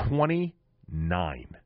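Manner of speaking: drawn out, heavy with disbelief; slow dramatic reveal. Real, not acted. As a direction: let the number land slowly.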